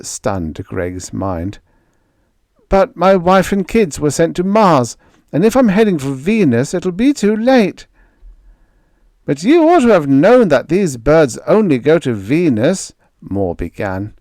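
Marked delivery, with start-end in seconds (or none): 2.7-7.9 higher, louder rising panic; desperate. A narrator's reading. Greg learns ship goes to Venus, desperate. 9.2-12.9 big loud blond spacer, higher and booming; jovial giant shouting introductions; hearty sailor Moore. A narrator's reading. Crewman greets groggy Greg, hearty and loud.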